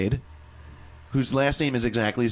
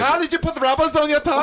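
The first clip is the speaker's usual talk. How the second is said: much higher, hammed-up German accent of a scolding mother; German mom accent; 13 semitones higher, 3 dB louder, faster than usual. Mimicking his German mother questioning him, German accent. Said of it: "do that in the accent".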